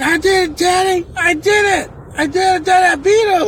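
put-on very high-pitched little boy voice; triumphant child. Son reporting his win to Daddy, imitated high triumph. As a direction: imitate a kid crowing to Daddy. Son bragging after winning race.

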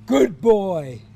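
loud, high mock outburst; hammed-up loud climax. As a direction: burst out loudly, imitating an excited student. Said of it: "he gets louder and louder".

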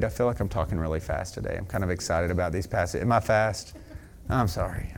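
low, soft off-the-cuff aside; quiet aside. Real, not acted. Preacher checking his pace with the congregation, quiet low aside. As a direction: drop low and soft, half to yourself.

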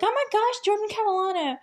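put-on high fangirl voice; mock fangirl swooning. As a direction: gush like a starstruck fangirl. Mimics swooning girls everywhere.

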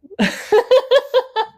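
high real laugh; burst of laughter. Real, not acted. Wife laughing at the idea of metal music during labor. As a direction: crack up at the metal music joke.

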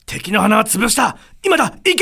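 slow, dramatic order to charge; rally the troops after a strike; acted battle cry. Performs listener's battle-cry entry. (芝居がかった突撃の号令)

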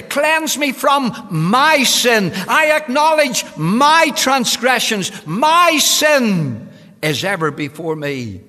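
loud, slow, stretched stress on every "my"; full-force preaching. Real, not acted. Preacher reads David's confession to congregation, full-force preaching. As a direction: hammer each "my" from the pulpit.

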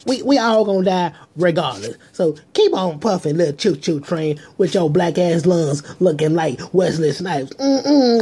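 put-on comedian voice, stretched words; terrible Cat Williams. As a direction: do a bad comic impersonation. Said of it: "terrible Cat Williams impersonation".

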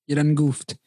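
usual speaking voice

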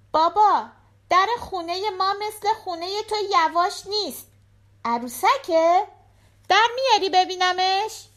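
put-on high, eager child's voice; little girl voice. A narrator's reading. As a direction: play the small daughter at the door. Daughter voiced.

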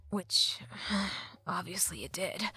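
soft resigned whisper. Acted. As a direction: breathe it out, barely voiced. Link admits dying, sighs.

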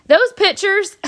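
high, laughing through the words. Real, not acted. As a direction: crack up remembering the photo. Laughing recalling daughter's photo.

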